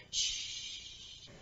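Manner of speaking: whispered shh; soft shush. Real, not acted. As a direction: shush them to sleep. Hushing the kids.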